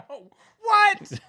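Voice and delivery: high, loud shocked outburst; laughing, high-pitched incredulous cry; shocked disbelief. Real, not acted. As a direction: shriek it in disbelief. Shocked by the absurd headline.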